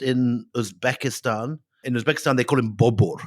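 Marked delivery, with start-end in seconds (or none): 2.8-3.2 "Bobur" with rounded o in place of a; "Bobur" with an Uzbek-style rounded vowel; Uzbek pronunciation. Shown as a demonstration. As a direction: say "Bobur" the Uzbek way, BAW-bor. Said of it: "the Uzbek pronunciation of his name".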